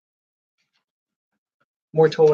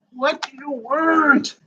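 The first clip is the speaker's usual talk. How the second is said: put-on voice; 8 semitones higher, much slower than usual. Put-on voice, repeat of line 48.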